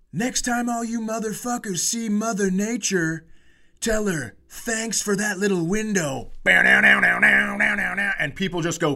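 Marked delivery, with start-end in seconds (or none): 0.1-6.3 higher, hyped rock frontman yell; rock star stage shout. Retold or imitated. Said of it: "he goes". 6.4-8.1 loud mouthed guitar riff bow down down; vocal drum riff. Retold or imitated. Comic imitating the band's riff, loud mouthed rock riff.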